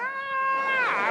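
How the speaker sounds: very high imitated squeal; mock delighted scream. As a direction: squeal like a thrilled wife. Acts wife's delighted scream.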